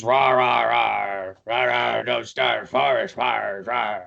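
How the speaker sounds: low growly bear ranger, slow warning; low bear roar with words in it; gruff Ursaring. Acted. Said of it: "he was".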